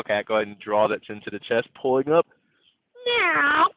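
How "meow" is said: high mock meow; a quick meow for the class; playful kitten meow; cat meow. Shown as a demonstration. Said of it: "Take your animal noise here".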